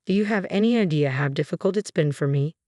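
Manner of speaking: ordinary delivery, nothing marked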